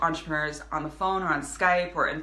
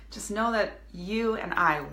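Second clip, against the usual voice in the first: ordinary delivery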